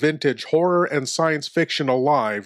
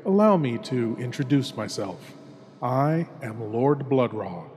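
hushed horror host; 4 dB quieter than usual. Acted. Horror host welcoming the lone listener into darkness, hushed and ominous. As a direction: draw the listener into the dark like a sinister host.

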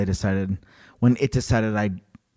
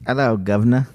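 mock Cockney greeting. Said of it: "Dave Anthony screaming".

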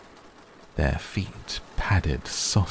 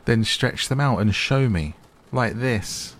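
high, challenging wild rabbit; lightly voiced rabbit taunting; daring; 6 semitones higher than usual. A narrator's reading. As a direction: dare him to show it.

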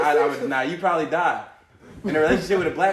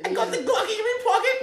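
very high put-on British voice with an Irish twang; squeaky mock British with Irish lilt; badly faked British accent, way up high; botched British accent. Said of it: "a little Irish twang on that thing".

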